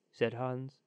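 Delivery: ordinary delivery, nothing marked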